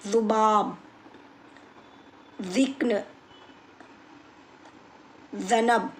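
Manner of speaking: ज़ुबाब, ज़िक्न, ज़नब each begun with "th" as in "this"; tongue at teeth: dhubaab, dhiqn, dhanab; dental dh variant. Shown as a demonstration. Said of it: "इस तरीके से भी लोग".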